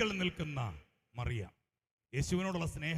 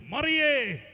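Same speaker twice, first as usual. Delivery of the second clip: high, loud call of a name; 7 semitones higher, 7 dB louder, much slower than usual. Retold or imitated. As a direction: call out to her suddenly, voice raised. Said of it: "യേശു അവളെ വിളിച്ചു". (ഉച്ചത്തിൽ പേര് ചൊല്ലി വിളി)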